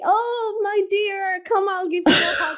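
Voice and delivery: high, cooing mock sympathy; mock benevolent. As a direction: coo it like an overly doting comforter, hammed up. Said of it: "It's not the benevolent looking down on you".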